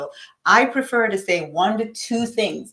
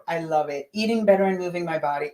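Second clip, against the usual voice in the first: usual speaking voice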